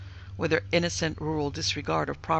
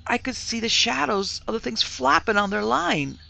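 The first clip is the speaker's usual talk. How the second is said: higher-pitched fearful telling; agitated account; 7 semitones higher, 4 dB louder than usual. A narrator's reading. Maid describing shadows to mistress, agitated. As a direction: report it in mounting agitation.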